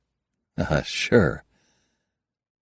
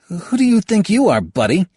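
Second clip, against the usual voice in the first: higher, louder startled retort; surprised; 13 semitones higher, 6 dB louder than usual. Acted. Halbert, startled by the intrusion, snaps back surprised. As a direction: bristle in surprise. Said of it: "Halbert's surprised".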